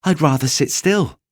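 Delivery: little rabbit fibbing. Acted. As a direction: hide the longing. Little rabbit refusing to dance.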